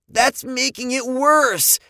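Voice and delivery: high, loud, slow panicky protest; panicked. A narrator's reading. Boy struggling with jammed drawstring, panicked cry. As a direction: wail it in rising panic. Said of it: "Andy panicked".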